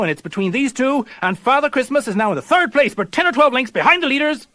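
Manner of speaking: rising, excited live race call; horse race commentary. Acted. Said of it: "Listen to this commentary".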